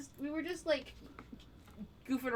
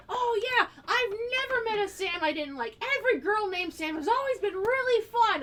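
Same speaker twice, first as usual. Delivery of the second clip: lightly voiced Scott; 8 semitones higher, 5 dB louder than usual. Quoting Scott McNeil at the con.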